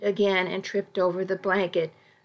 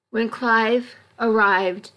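plain reading voice

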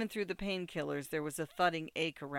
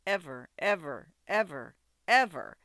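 each EVER punched separately, pitch falling; emphatic; 6 dB louder than usual. A narrator's reading. Sasha forbids Bobby doing that again, emphatic. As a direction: hammer every "ever" as its own warning.

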